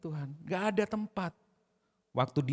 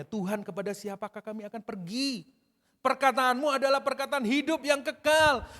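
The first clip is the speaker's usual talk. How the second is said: high, raised-voice declaration of faith; scripture declamation; 9 semitones higher, 4 dB louder than usual. Acted. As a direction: proclaim it to the Lord, pitched high. Declaims Peter's reply from scripture.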